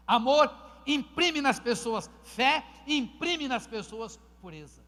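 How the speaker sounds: loud, slow, hammering refrain; full-force preaching. Acted. Pastor to his church on leaders as models, thundering. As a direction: drive each word home to the church.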